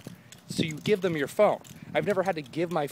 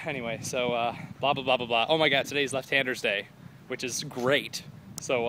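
ordinary talk

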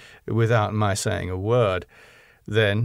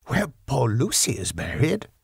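amazed; 3 semitones higher than usual. A narrator's reading. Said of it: "in an amazed sort of way".

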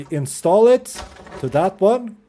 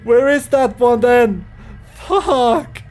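usual speaking voice

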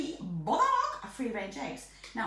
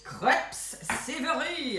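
hammed-up French accent on "crepes savory"; 3 dB louder, slower than usual. Baker telling viewers about savory crepes, mock French accent. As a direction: put on a showy French accent, stretching "savory". Showy French saying, then plain repeat.

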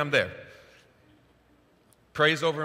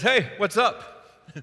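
chill; 8 semitones higher, 6 dB louder, much slower than usual. Retold or imitated. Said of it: "this is so chill".

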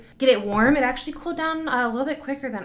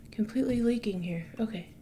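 whispering; 7 dB quieter, faster than usual. Real, not acted. Aside while working.

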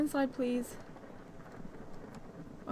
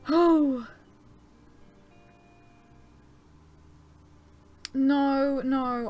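loud dismay; 9 dB louder, much slower than usual. Real, not acted. Dismayed by the storm.

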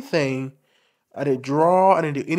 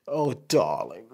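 slow, lower, lightly camp "darling"; feminine mannerism; 5 semitones lower, 4 dB quieter, much slower than usual. Shown as a demonstration. Showing viewers his feminine mannerisms, slow camp drawl. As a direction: drawl it out like a gracious diva. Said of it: "I may talk with my hands like this".

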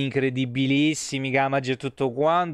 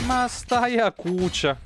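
mock scolding; 8 semitones higher, slower than usual. Scolding a game creature.

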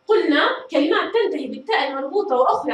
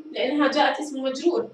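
ordinary talk

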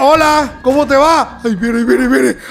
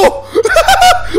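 squeaky burst of laughter; high laugh. Real, not acted. Gamer laughing at a fleeing animal, high giggle.

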